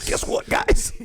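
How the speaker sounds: put-on breathy laughing gloat at the boys; giggling brag. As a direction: crow over the win through laughter. Gloating after winning the bet.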